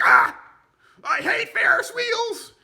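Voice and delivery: high, loud, drawn-out squeal; whiny gang leader. Retold or imitated. Gang leader whining loudly at the fair. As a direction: squeal it like a spoiled brat.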